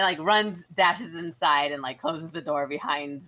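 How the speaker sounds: usual speaking voice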